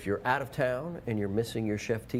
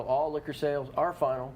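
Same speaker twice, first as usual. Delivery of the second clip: ordinary talk, nothing marked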